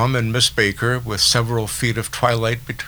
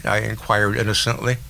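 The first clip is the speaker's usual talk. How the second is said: plain reading voice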